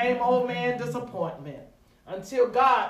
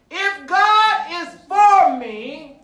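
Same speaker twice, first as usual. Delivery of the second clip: loud, high, drawn-out proclamation; slow, triumphant pulpit declaration; resounding answer; 8 semitones higher, 12 dB louder, much slower than usual. Acted. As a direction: ring it out to the whole church. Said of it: "the resounding answer came".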